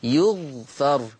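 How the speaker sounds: يغفر very slowly, yughfar with damma on ya and fatha on fa; Warsh reading. Shown as a demonstration. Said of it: "بضم الياء وسكون الغين وفتح الفاء".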